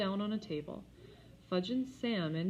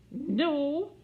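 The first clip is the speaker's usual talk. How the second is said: firm no; 10 semitones higher, 8 dB louder than usual. A narrator's reading. Firm refusal.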